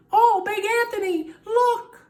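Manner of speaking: high, loud alarmed cry of a nun; nun's cry. A narrator's reading. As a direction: warn him in a shrill high voice. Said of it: "a sister from the convent said".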